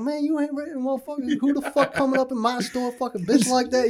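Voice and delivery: high-pitched, worked-up retold rant; indignant boss backing his employee, pitched right up; mock indignant manager. Manager defending his worker from a customer, mock indignant.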